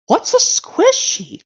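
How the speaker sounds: What's a squishy very high and slow, cartoon style; cartoon dragon voice. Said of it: "that goes like this".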